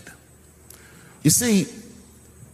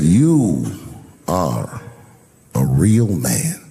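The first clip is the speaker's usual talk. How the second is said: lower, slow and tender; tender praise; 10 semitones lower, much slower than usual. Retold or imitated. Mobster tenderly praising him.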